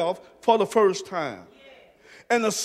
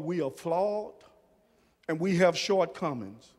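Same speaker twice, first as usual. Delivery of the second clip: quiet confession; 7 semitones lower, 9 dB quieter than usual. Acted. Confession voiced to the Lord.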